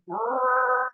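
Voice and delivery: long trilled grunt of effort; straining growl. Retold or imitated. Sage clinging to the ladder, straining growl.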